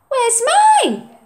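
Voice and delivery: high, loud complaint; indignant boy. Acted. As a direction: feel left out. Oliver's voice.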